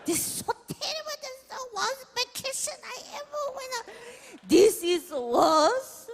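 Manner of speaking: very high, half-crying mock mother; whiny mom impression. Mom moaning about her worst vacation, hammed-up crying. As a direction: wail like a wounded diva mom.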